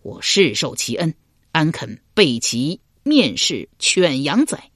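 usual speaking voice

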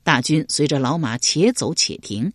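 plain reading voice

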